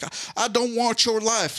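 high, slow, pleading movie-hero quote; Varsity Blues movie line. Retold or imitated. Quoting a Varsity Blues moment to the coach, high and slow. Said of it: "Varsity Blues type of moments".

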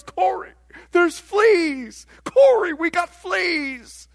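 high, loud delighted cry; acted-out high-pitched exclaiming; excited announcing. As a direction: imitate someone thrilled with big news. Betsy excitedly announcing fleas.

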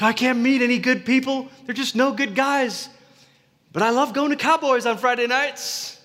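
high, whiny mock voice of a complaining single; sing-song the excuse like a frustrated single; whiny complainer. Pastor mocking a churchgoer's dating complaints, high whiny voice.

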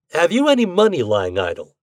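spring the question without warning; abrupt question. A narrator's reading. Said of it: "he asked abruptly".

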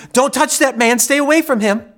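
high, loud frantic warning; yelling. Retold or imitated. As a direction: shout to pull children away.

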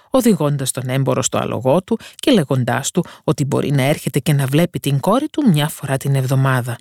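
plain reading voice

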